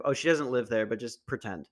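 ordinary delivery

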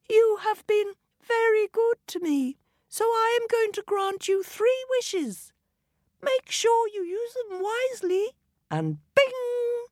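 very high, light fairy voice; sparkling tiny fairy granting wishes. A narrator's reading. Fairy grants the couple three wishes, high and light.

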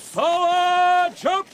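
high, ringing order to march; command shout. Acted. Captain ordering the troop to ride out, ringing shout. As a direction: call the troop forward.

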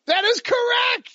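high, loud game-show cheer; excited shout. Real, not acted. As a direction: call it out like a quiz host. Host cheers a right answer.

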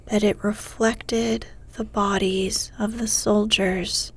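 ordinary delivery, nothing marked

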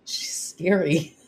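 slow, drawn-out mock spook; slow drawl. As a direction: stretch it out.

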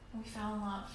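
usual speaking voice

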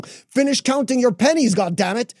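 irritated, triggered. Acted. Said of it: "You're getting triggered in that moment".

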